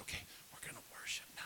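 quick, hushed whisper. Retold or imitated. Student leader opens a secret worship service, whispering. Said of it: "we were whispering".

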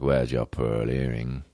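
slow, low mock period-drama voice; drawn-out, low put-on movie voice; pretend period-film actor. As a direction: play a hammy costume-drama gent, slow and low. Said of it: "I'm like".

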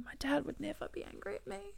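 high, soft, choked voice; close to tears. Real, not acted. Daughter speaking of dying dad, tearfully. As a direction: let the voice go small and tearful.

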